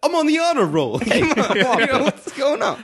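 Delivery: high-pitched pleading through laughter; mock indignant protest. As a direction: plead your case in hammed-up disbelief.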